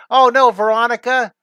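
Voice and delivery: loud, high playful "oh no"; mock dismay. Real, not acted. Teacher reacting to Veronica's comment, mock dismay. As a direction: exclaim in playful alarm at a comment.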